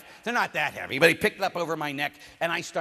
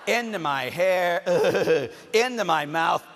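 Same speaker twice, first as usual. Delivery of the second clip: laughing; 5 dB louder, slower than usual. Real, not acted. Laughing at being urinated on.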